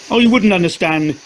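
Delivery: shouted. Acted. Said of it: "she shouted".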